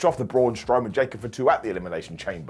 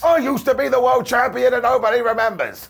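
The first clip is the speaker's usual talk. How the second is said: imitating a shouting big man, much higher and louder; mock shout; 10 semitones higher, 4 dB louder than usual. Braun Strowman mocked for shouting his complaint. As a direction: bellow it like an aggrieved brute. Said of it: "Nobody Shouts Like That".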